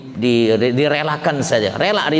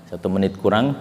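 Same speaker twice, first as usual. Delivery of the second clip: ordinary talk, nothing marked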